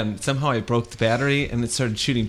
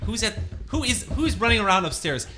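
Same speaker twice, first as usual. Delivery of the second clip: scolding dad; 9 semitones higher than usual. Acted. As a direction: call the kid down sternly. Father scolding improv.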